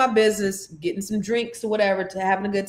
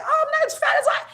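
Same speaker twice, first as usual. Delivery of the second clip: high, laughing relief; giddy laughing relief at the scale; laughing surprise; faster than usual. Retold or imitated. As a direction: squeal it out through laughter. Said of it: "pleasantly surprised".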